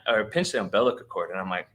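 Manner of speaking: usual speaking voice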